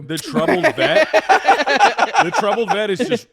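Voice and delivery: high, slow words through laughter; giggling out the words; laughing. Real, not acted. Friend recalling the troubled vet, laughing through words.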